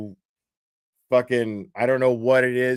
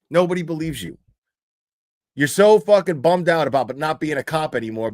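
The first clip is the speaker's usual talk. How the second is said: ordinary delivery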